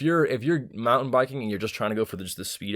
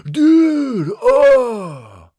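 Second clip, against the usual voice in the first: surfer dude voice; 13 semitones higher, 10 dB louder than usual. Retold or imitated. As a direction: cry it out like an offended surfer dude. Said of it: "how you stereotype that surfer's voice".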